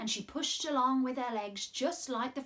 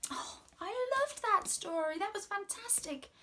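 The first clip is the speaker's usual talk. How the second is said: delighted; 10 semitones higher than usual. Real, not acted. Host reacting to story.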